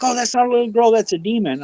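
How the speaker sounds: high-pitched mock panic; neighbor wigging out. Neighbor sure the little girl is a demon, imitated wigging out. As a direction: imitate her spooked insistence, pitched well up. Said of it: "wigging out".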